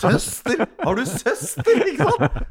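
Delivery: loud, high-pitched double question; astonished. Acted. Friend learning of an unknown sister, loud astonishment. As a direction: burst out in acted disbelief.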